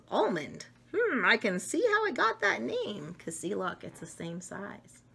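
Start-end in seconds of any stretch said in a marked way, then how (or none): none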